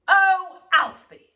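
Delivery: loud, high, drawn-out moan; a mother groaning at the mess; moaning mum. A narrator's reading. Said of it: "moaned Mum".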